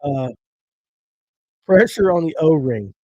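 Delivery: ordinary delivery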